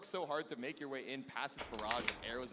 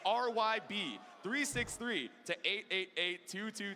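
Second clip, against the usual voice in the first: each digit said slowly and clearly, a bit higher; slow spelled-out code; 5 semitones higher, slower than usual. Shown as a demonstration. Caster reading a text code to the crowd, slowly and clearly. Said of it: "Big shout out to you guys".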